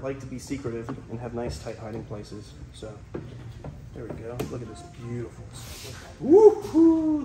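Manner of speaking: hushed nature-show host voice; excited wildlife host whisper. Real, not acted.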